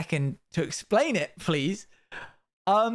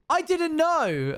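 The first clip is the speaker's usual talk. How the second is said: high slow shocked whine; mock shocked viewer; 12 semitones higher, much slower than usual. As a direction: squeak it out slowly like a crushed fan. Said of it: "someone's gonna be like".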